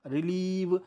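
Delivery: "रिलीव" with the ee held long; drawn-out vowel. Shown as a demonstration. Teacher repeating the spelling example, "रिलीव" with stretched ee. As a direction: draw out "रिलीव" on its second syllable. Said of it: "बड़ा sound खींचना पड़ रहा है".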